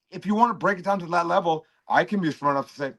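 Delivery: ordinary talk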